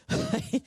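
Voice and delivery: laughing at her own line; high delighted laugh. Real, not acted. Guest laughing at her quoted reply.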